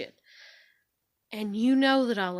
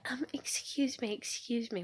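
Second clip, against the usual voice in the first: imitating smug politeness, higher and softer; mock a smug interruption in a small, raised voice; smug smartass; 3 semitones higher, 5 dB quieter than usual. She interrupts my YouTube video, imitated smug smartass. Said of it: "it's in a smug way".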